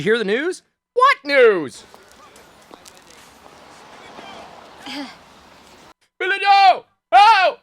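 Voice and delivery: high yelled background line, rising to a shriek; shrieking Billy Joe callout; shouted movie line. Retold or imitated. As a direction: belt out the silly background shout. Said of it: "is it right here".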